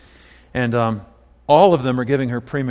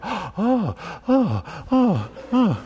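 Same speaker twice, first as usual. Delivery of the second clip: awed gasps; 7 semitones higher, 4 dB quieter, much slower than usual. Retold or imitated. Clients gasping at the new plants.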